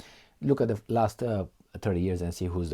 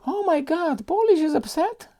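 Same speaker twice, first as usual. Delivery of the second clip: much higher mock gasp; mock alarm; 5 dB louder than usual. Mocking Poland's upset over Belarus, sarcastic high gasp. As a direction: pretend to be shocked, sarcastically.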